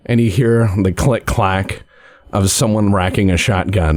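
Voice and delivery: ordinary talk, nothing marked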